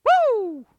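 high loud cartoon whoop; excited whoop. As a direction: let out a high gleeful whoop.

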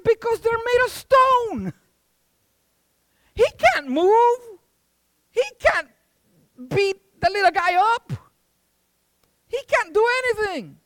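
high, loud, emphatic shouting; exasperated dad. Acted. Dad insisting to his son the statue is lifeless, exasperated. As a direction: hammer out each phrase in acted frustration.

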